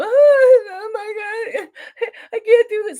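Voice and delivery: high, loud fake sob imitating her; mock sobbing. Vlogger mocking a witness's tearless courtroom sob. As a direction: wail it like a staged breakdown. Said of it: "at one point she sobbed".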